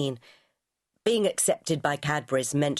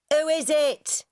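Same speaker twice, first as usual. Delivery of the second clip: shout; 11 semitones higher, slower than usual. A narrator's reading. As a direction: call through the house to the door. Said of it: "heard Ethel shout".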